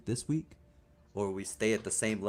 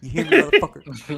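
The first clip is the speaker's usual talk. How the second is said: loud, higher challenge with laughter around it; mock threat; 5 semitones higher, 9 dB louder than usual. Podcast host calling out absent Mike, loud mock threat. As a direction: call him out louder, play-fighting.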